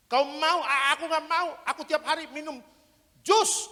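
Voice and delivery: a tipsy Batak pal teasing; drunk Batak man. Retold or imitated. Drunk Batak offering drink to Noah.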